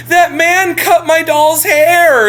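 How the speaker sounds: put-on retelling the girl wailing to the adults about her doll; mock wailing girl. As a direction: wail it like a little girl who is upset.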